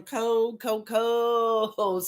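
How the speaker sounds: slow, stretched-out "cold"; "cold" held long; drawn-out COLD. Real, not acted. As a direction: drag out each "cold" for emphasis. Stressing how cold it is there.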